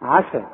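عسى with a light, fronted vowel after ع; correct light Ayn. Shown as a demonstration. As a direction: keep the ع of عسى thin. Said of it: "العين عا".

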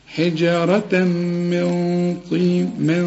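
slow chanted حجارة من طين with a heavy nasal من; heard ghunna. Shown as a demonstration. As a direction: recite with full nasal hiding. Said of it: "اسمعها".

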